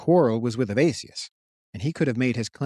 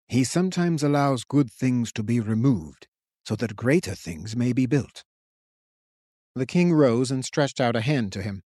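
put-on gentle old king. A narrator's reading. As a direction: console him like a patient teacher. King consoles grieving Curian.

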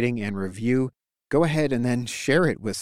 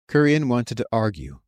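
usual speaking voice

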